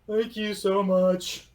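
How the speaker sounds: high, loud, slow golem voice; weepy golem. Acted. Golem handing back the soaked handkerchief, high, loud and slow. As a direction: sob it out like a giant stone golem. Crying golem thanks again.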